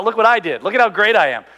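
mock bragging. Preacher mocking Samson's self-praise, in a loud boast. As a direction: puff up and crow about yourself. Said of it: "He's basically talking about".

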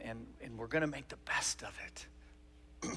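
near-whispered, slow consolation; soft, breath-heavy fatherly comfort; faltering reassurance. Acted. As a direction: trail into a slow, hushed promise. Dad's faltering close to hard talk.

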